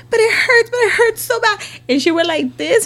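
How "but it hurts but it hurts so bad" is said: high-pitched mock wailing; put-on child's complaint, high and pleading; imitated dramatic child. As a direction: whine it like a hurt, dramatic child. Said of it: "Stop being so damn dramatic".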